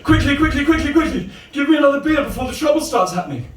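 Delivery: high-pitched urgent plea; urgent, high comic voice; frantic bar customer. Acted. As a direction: rush up to the bar in a panic. Same frantic customer orders again.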